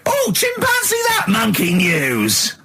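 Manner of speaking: high, drawn-out show catchphrase; jingle announcement. Acted. Performs the Monkey News jingle call.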